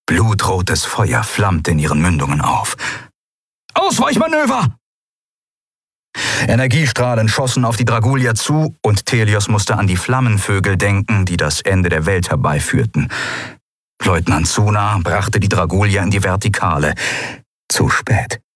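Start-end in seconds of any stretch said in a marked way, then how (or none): 3.7-4.7 high, urgent yelled command; a sudden cry for evasive action; shouted order. Acted. Battle command on the bridge. 17.7-18.4 unvoiced, hushed "Zu spät"; a breathed, doomed "Zu spät"; breathy aside. A narrator's reading. Narrator's grim aside.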